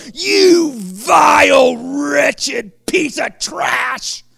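slow, loud, high scorn; drawn-out scornful condemnation; contemptuous shout. Pretend. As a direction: spit out the insult at the sinner. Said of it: "he didn't look at Matthew and go".

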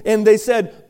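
usual speaking voice

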